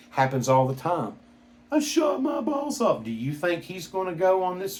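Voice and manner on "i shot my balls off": higher mock voice of the hurt man; wounded man's cry, repeated. Vlogger repeating the injured man's cry for effect, higher mock voice. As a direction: echo the hurt man's line, high and pained.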